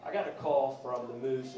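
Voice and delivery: ordinary talk, nothing marked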